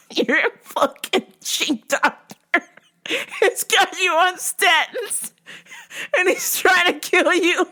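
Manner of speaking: high, laugh-cracked words; talking through helpless laughter; wheezing with laughter while retelling. Real, not acted. Laughing at the caller's claim.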